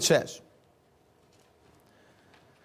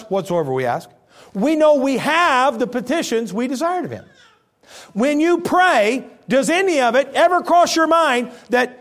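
fervent preaching; 7 semitones higher, 3 dB louder than usual. Acted. Preaching at full force.

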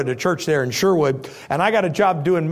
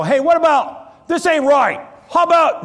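high, loud complaining whine; mock protesting John; 10 semitones higher, 5 dB louder than usual. John as he would not talk to the Lord, mock protest. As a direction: play John arguing back.

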